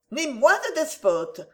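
firm and level retort. A narrator's reading. Clémence refusing sir George's demand, firm. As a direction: stand your ground, calmly firm. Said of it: "d'une voix ferme".

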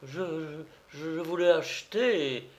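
hesitant stammer of a flustered suspect. A narrator's reading. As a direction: falter on the first word, meekly. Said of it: "balbutia Du Mollet".

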